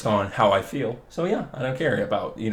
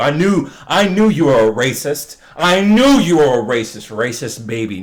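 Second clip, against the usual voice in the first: mock accusation; 3 semitones higher, 7 dB louder than usual.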